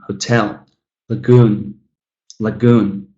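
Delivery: "Hotel, lagoon", each stressed on its final syllable; ho-TEL, la-GOON, la-GOON; second-syllable stress. Shown as a demonstration. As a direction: lean on the ends of hotel and lagoon. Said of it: "stress falling on the second".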